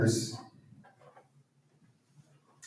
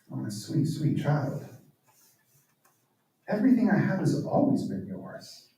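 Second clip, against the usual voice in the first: tender father; 3 semitones higher, 5 dB quieter than usual. Retold or imitated. Father tenderly answers older son.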